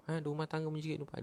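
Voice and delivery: rapid throwaway listing; rushed aside. Real, not acted. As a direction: rattle it off quickly in one breath. Listing kinds of households.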